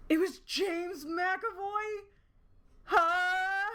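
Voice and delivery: high, slow, incredulous question; can't believe the casting; stunned disbelief. Real, not acted. Gamer learning the voice actor, high, slow stunned disbelief.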